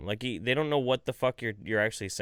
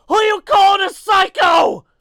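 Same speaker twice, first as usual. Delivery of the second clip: much higher, slow, very loud shout; scream; 16 dB louder, slower than usual. Shown as a demonstration. Teacher shocking a noisy class, screaming. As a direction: yell it out of nowhere. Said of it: "and then scream out".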